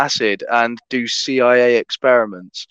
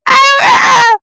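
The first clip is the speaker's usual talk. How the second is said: dolphin squeal; 8 dB louder than usual. Retold or imitated.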